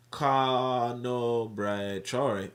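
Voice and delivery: slow, syllable-by-syllable attempt at a name; sounding out. Real, not acted. Host reads out an unfamiliar chief's name, sounding it out. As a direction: stretch each syllable while working out the name.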